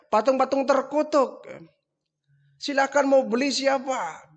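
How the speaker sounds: a young trader peddling statues he mocks; street hawker calling. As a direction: call out to every passer-by. Hawking statues to villagers.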